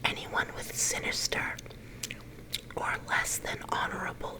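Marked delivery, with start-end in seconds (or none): none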